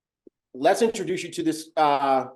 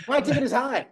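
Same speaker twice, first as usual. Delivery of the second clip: gloating; 8 semitones higher than usual. Pretend. Repeated louder, higher boast.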